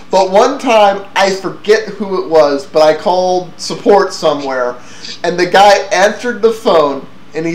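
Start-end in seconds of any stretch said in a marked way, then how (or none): none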